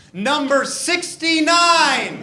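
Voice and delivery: number drawn out high and slow, like a TV countdown host; milk the big reveal, slow and high; mock countdown announcement. Comic stretching the countdown reveal for the crowd, in a hammed-up announcer voice.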